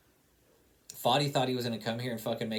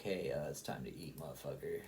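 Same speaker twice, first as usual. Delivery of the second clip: low, soft grumbling stomach voice; stomach talking; 6 semitones lower, 8 dB quieter than usual. Pretend. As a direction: mumble it low like your hungry stomach. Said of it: "my fucking stomach's growling at me like".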